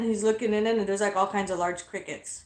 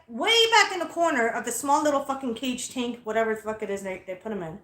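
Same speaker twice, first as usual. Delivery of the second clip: ordinary delivery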